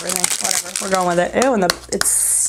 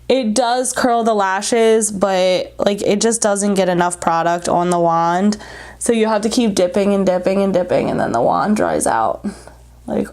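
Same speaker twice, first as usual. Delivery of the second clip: normal talking voice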